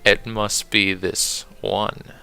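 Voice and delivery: fancy British poetry recital; British accent. Acted. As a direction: read the poem in a put-on British accent. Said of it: "I gotta do it on British accent".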